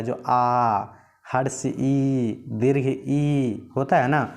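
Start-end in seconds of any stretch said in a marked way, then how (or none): 0.3-0.8 आ drawn out long and pushed with force; a long, forceful आ sound. Shown as a demonstration. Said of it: "आ बोलना है तो इसमें जोर देना पड़ता है". 1.3-3.7 हर्ष ई said very slowly, each sound spelled out; slow teacher's demo of हर्ष ई and दीर्घ ई; slow vowel demo. Shown as a demonstration. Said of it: "जोर देना पड़ता है".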